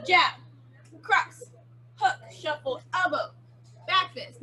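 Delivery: slow punch count, a pause after each move. Shown as a demonstration. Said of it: "go kinda slow and then we'll speed it up".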